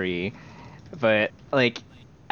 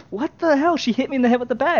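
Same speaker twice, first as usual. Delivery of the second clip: hammed-up furious kid; indignant outburst; 13 semitones higher than usual. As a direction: protest the injustice in a rush. Indignant victim protesting.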